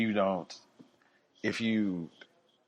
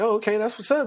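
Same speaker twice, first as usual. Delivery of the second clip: much higher, upbeat cop voice; 12 semitones higher, 4 dB louder, faster than usual. Retold or imitated. Officer chats about football with the driver, much higher and upbeat. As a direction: sound impressed and casual.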